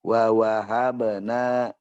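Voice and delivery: no sudden jolt; slow, unhurried. Shown as a demonstration. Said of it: "jangan terlalu dikejut".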